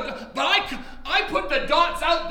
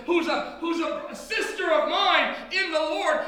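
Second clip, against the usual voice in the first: normal talking voice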